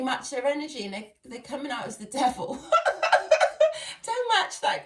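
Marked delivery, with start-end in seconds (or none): 2.6-4.0 high, loud burst of real laughter; sudden high giggling fit. Real, not acted. Laughs at the devil card. 4.0-4.8 warning said through laughter; laughing out a quick warning. Real, not acted. Laughing while warning viewers.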